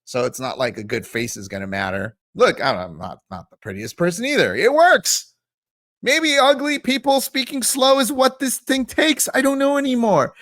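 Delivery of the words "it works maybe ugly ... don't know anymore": high-pitched, worked-up bafflement; exasperated disbelief. Real, not acted. YouTuber baffled by a rival's success, high exasperated outburst. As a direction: pitch climbs as if at wits' end.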